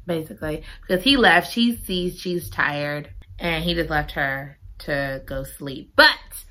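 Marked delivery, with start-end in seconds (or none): none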